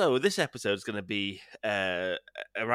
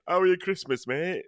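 cheery greeting; 10 semitones higher, 6 dB louder than usual. Pretend. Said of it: "we can't say".

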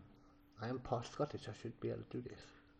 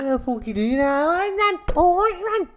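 high, loud mock Scouse; Scouse accent; 14 semitones higher, 8 dB louder than usual.

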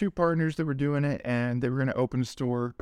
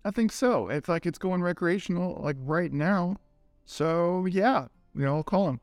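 excited; 6 semitones higher than usual. Retold or imitated. Retelling his excited reply to friend.

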